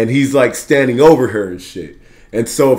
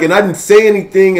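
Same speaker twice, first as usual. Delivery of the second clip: imitated sister; 6 semitones higher than usual. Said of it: "The sister comes out".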